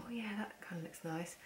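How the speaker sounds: soft whisper of a passing thought; whispering to herself in the shop. Retold or imitated. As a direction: murmur it to yourself, tempted. Retelling her thought in the shop.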